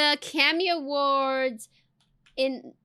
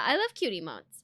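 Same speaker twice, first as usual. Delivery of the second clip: ordinary talk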